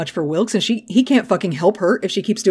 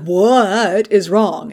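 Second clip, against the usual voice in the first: slow worried demand; much slower than usual. Retold or imitated. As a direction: drag out each word, worried. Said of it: "he's like".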